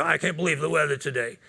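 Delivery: lightly put-on Italian-American Brooklyn accent. Stranger getting into the elevator, Italian Brooklyn accent. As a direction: mimic a Brooklyn Italian making small talk. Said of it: "This is my Italian, uh, Brooklyn accent".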